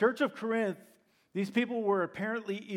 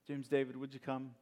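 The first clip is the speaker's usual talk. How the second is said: usual speaking voice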